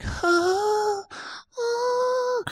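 very high puzzled huh; confused squeak. Retold or imitated. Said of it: "I'm like".

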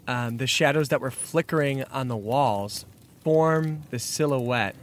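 normal talking voice

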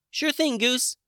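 tiny high fairy voice; squeaky, small sprite. A narrator's reading. Sprite answers.

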